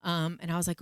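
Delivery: usual speaking voice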